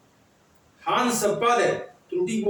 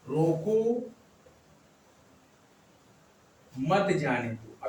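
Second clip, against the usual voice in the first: lower-pitched rising रोको, long silence, slow low मत जाने दो; long pause after रोको; 4 semitones lower, 4 dB quieter, slower than usual. Shown as a demonstration. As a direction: hold a long stop after रोको, then stretch मत जाने दो. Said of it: "अब ये हो गया". (रोको के बाद लंबा विराम, फिर धीरे से मत जाने दो)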